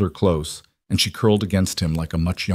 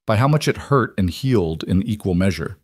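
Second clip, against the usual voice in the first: usual speaking voice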